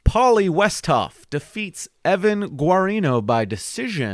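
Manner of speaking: high announcer call. Acted. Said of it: "I sound like a Bates Ball announcer".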